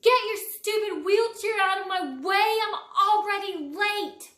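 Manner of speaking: loud, high angry holler at a classmate. Acted. A J hollers at classmate blocking his way, loud angry yell. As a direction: yell it out, rude and rushed. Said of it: "A J hollers".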